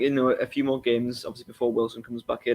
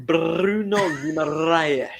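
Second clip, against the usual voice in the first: put-on slow, drawn-out Brazilian accent on "Bruno Guimaraes", r's dropped to "Buno Umaraiesh"; hammed-up Brazilian accent; 4 semitones higher, much slower than usual. Having a go at the player's name after his co-host, in a hammed-up Brazilian accent. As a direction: show off an over-the-top Brazilian accent, stretching every syllable. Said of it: "Let me have a go".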